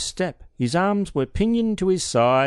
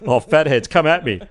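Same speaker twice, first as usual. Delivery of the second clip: mock taunt; 2 semitones higher, 6 dB louder than usual. Mock challenge to listener.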